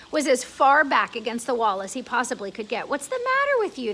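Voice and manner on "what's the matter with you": grasshopper asking. Acted. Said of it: "the old green grasshopper asked".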